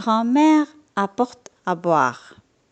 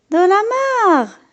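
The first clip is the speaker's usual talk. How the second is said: exclaiming; 10 semitones higher, 4 dB louder, faster than usual. A narrator's reading. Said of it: "s'exclame grand-père".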